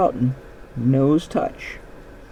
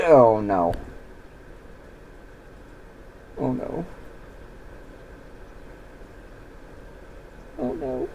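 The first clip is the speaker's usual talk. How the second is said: dismayed horse fading; 10 dB quieter than usual. Retold or imitated. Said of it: "the horse is like".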